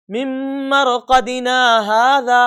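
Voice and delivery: no saktah after مَرْقَدِنَا; wrong reading, one breath; wrong reading, no pause. Shown as a demonstration. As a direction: run مَرْقَدِنَا into هَذَا without stopping. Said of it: "بغیر رکے ہوئے آگے پڑھتے چلے گئے".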